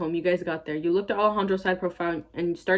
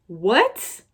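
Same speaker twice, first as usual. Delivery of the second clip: baffled; 3 semitones higher, 6 dB louder than usual. Real, not acted. Baffled by the grammar.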